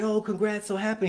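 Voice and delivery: high-pitched, gushing acted praise; excited congratulations. Dad to his son after the jump, high excited congratulations. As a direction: congratulate him in a high, happy voice.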